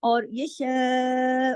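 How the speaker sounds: शाह drawn out long and slow; stretched madd. Shown as a demonstration. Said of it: "लंबा खींचना है क्योंकि उसके ऊपर मद है". (शाह को लंबा खींचकर)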